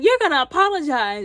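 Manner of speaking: stern demand. Retold or imitated. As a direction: insist firmly as the scolding parent. Father insists on an apology.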